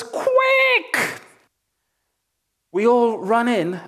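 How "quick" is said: very high shrieked "Quick!" in a mother's panicked voice; mum's scream. Retold or imitated. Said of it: "She screams and she goes".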